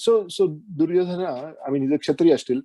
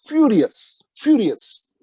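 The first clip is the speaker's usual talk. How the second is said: emphatic; 9 semitones higher, 3 dB louder, much slower than usual. A narrator's reading. Narrator stresses Bhishma's rage.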